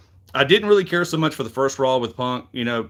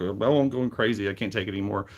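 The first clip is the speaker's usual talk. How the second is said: mock whiny; 5 semitones lower, 5 dB quieter than usual.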